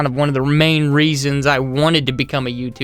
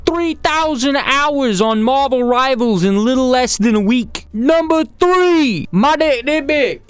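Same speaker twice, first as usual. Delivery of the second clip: higher-pitched, hammed-up fun-fact announcer; hype announcer; 7 semitones higher than usual. Vlogger presenting fun facts about himself, hammed-up announcer. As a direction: belt out mock boasts like a hype man.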